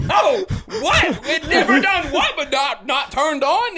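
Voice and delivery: high, loud comic outburst; panicked disbelief. Retold or imitated. Stranded passenger hearing the engine has failed, high loud panic. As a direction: blurt it in alarmed disbelief.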